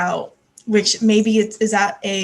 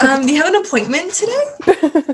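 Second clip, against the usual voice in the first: high, chirpy front-desk voice; bright polished phone greeting; 7 semitones higher, 4 dB louder than usual. Pretend. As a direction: play the overly polite receptionist. Mock receptionist greets friend.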